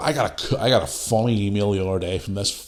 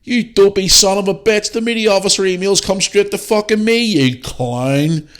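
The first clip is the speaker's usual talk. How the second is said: higher and louder, scornful tirade; scoffing at a troll, higher and louder; angry retort; 11 semitones higher, 5 dB louder than usual. Retold or imitated. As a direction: tear into the emailer loudly. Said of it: "and I'm like".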